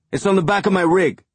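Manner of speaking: high, loud, fast call; shouted, quick. A narrator's reading. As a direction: rattle it off at full voice. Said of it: "Amos shouted".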